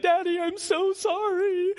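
put-on high, loud little-kid voice, near tears; crying little boy. Three-year-old apologizing to daddy after hitting him, high tearful child voice. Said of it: "He's crying. He's like".

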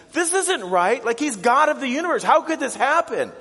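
high, loud outraged disbelief; hammed-up anguished shouting; distraught. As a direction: cry out in acted shock and protest. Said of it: "they're just so distraught".

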